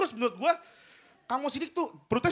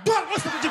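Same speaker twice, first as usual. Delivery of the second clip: crash noises; 3 dB louder than usual. Shown as a demonstration. Pothole crash sound effects.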